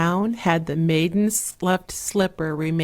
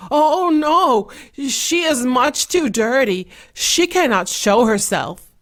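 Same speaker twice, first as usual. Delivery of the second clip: higher, louder stepmother voice, hastily refusing; stepmother protesting; 6 semitones higher, 6 dB louder than usual. A narrator's reading. Stepmother answers the prince, protesting loudly and high. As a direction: brush the idea off sharply. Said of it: "the mother answered".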